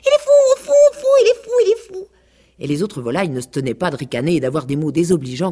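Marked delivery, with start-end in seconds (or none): 0.0-2.1 very high, loud squeaky chant; chirping chicks. A narrator's reading. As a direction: cheep it like a brood of chicks. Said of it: "en piaillant".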